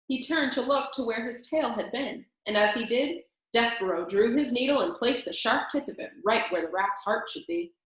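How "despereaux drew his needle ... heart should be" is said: ordinary delivery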